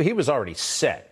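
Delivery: normal talking voice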